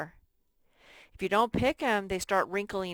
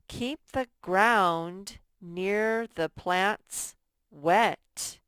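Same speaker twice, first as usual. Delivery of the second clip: slow and louder, each word spaced out; slow emphatic reading; 6 dB louder, much slower than usual. Shown as a demonstration. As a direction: read it slowly and loud for young readers. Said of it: "teacher reads model sentence".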